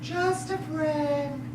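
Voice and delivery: slow, soft quote of a caller; JUST A FRIEND drawn out quietly; hushed, measured mimicry; imitating the caller. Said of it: "How did she say it?".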